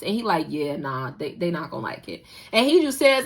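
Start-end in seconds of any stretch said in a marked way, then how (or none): 0.5-2.3 low mumbled admission. Retold or imitated. Aide admits bluntly.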